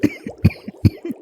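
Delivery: sudden sharp coughing fit; harsh cough. Acted. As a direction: cough as if fumes catch the throat. Marie coughing over smelting fumes.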